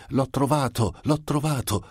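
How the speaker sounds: put-on high, thrilled child's cry of discovery; joyful cry. A narrator's reading. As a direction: call it out like a kid who found the prize. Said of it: "Pazza di felicità si mise a gridare".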